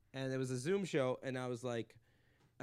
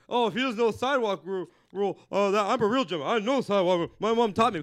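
loud, higher prissy mocking voice; mock cuck man; 6 semitones higher, 11 dB louder than usual. Mocking the so-called gentleman man, loud and high. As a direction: sneer at the self-styled gentleman. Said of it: "cuck man that's like".

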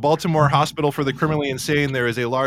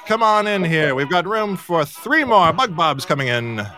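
higher, brighter hosting announcement; announcer voice; 5 semitones higher, 5 dB louder than usual. Acted. Host invites the chat in, announcer-style and upbeat. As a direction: welcome the chat in like a show host.

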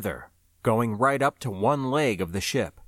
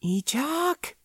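higher calling voice. Acted. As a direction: call his name after him. Floy calls after him.

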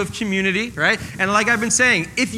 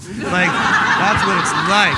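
laughing through the punchline; 5 dB louder, much slower than usual. Real, not acted. Joke about Jersey.

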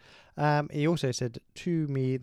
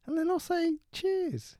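high squeaky exasperated voice; high mock voice. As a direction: squeak it out, annoyed. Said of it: "I'm like".

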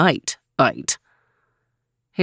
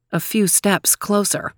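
plain reading voice

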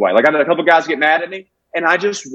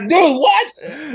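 high-pitched stunned disbelief; incredulous; 12 semitones higher than usual. Retold or imitated. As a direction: blurt it out in baffled protest. Reacting in disbelief to opponent.

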